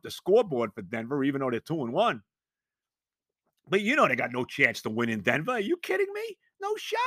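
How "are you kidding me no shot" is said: high incredulous scoff. Real, not acted. Fan scoffing at Jets' chances in Denver, high disbelief.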